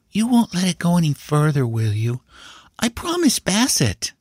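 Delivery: put-on high, pleading child's voice; small boy begging secrecy, pitched high; high-voiced earnest nephew; anxious young boy. A narrator's reading.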